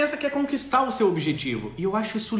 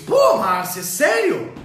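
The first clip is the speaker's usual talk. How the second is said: louder, higher disbelief; surprised outburst; 8 semitones higher, 7 dB louder, much slower than usual. Real, not acted. Host reacting to viewer Márcia's message, astonished. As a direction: react in shock.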